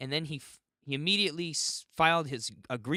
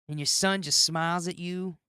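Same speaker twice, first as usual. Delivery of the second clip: normal talking voice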